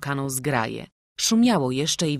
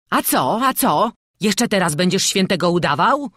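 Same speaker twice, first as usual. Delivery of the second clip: loud, raised-pitch jeering; shouted taunt; 4 semitones higher, 8 dB louder than usual. A narrator's reading. Someone in the crowd taunts the prisoner, shouting. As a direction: shout it accusingly right into his ear. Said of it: "krzyknął mu ktoś nad samym uchem".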